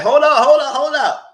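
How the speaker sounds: high, loud, repeated calls; alarmed intervening. Retold or imitated. Onlookers stopping him from hitting a female, loud intervening. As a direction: shout to break up a fight, retold.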